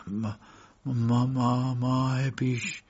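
hesitant stammer on the first word. A narrator's reading. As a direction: stumble over the opening word, then stretch it out. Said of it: "مرد کمی من و من کرد".

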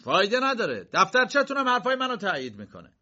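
bold, resounding; ringing voice. A narrator's reading. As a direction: proclaim it to the whole hall. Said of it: "با صدای رسا گفت".

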